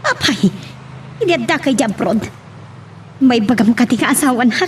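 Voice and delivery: usual speaking voice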